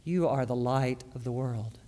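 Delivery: hushed reflective repeat; quiet echo. Shown as a demonstration. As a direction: let You are the light of the world fall soft and low. Quiet repeat after emphatic take.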